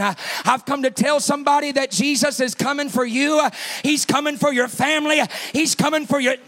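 fervent, climbing proclamation; building preaching. Real, not acted. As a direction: drive each repeated promise harder.

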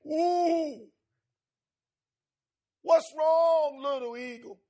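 high, loud hooting owl calling out to the eagle; sermon story owl, high and loud; wise owl voice. Acted.

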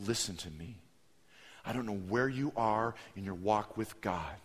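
low, soft earnest appeal; solemn preaching. Real, not acted. Preacher addresses listeners' walk with God, hushed earnest appeal. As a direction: speak quietly and intimately to the congregation.

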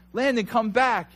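high, loud call to come back; retold yell. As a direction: imitate yelling after a runaway son. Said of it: "Yelling out".

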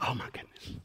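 breathless whisper of alarm; hushed gasp. Retold or imitated. Pastor sees the trooper ahead, whispering in dread. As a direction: whisper it as you spot the trooper. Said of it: "And I'm like".

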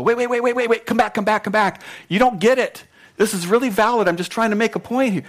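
high, urgent mock pleading; Jesus, frantically pleading. Preacher imagining Jesus begging departing disciples to return, frantic and high. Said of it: "Jesus didn't jump up and go".

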